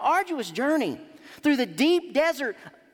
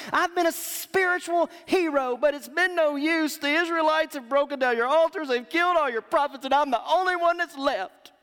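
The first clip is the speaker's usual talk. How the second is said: self-pitying Elijah; 3 semitones higher than usual. Retold or imitated. Elijah complaining to God.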